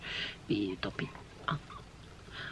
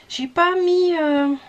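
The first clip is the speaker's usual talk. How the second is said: normal talking voice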